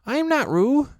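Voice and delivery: high, loud squeak of protest; loud squeaky protest. A narrator's reading. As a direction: insist loudly in a tiny voice. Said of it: "said Piglet loudly".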